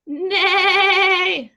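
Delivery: high, wobbling whinny; long, loud quavering neigh; horse neigh. A narrator's reading. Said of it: "said a horse".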